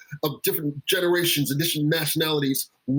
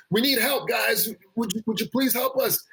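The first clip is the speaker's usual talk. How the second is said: mock screamed plea; 7 semitones higher than usual. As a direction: imitate a mild scream for help. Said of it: "screaming like a kettle".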